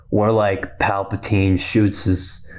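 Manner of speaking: ordinary delivery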